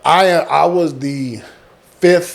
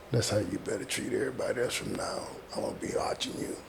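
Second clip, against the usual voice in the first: low whisper in the ear; 4 semitones lower, 18 dB quieter, faster than usual. Retold or imitated. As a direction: whisper it like a quiet threat. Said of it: "right in my ear".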